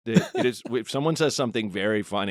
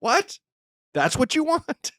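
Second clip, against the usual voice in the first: high disbelief; slower than usual. Acted. Mocking disbelief.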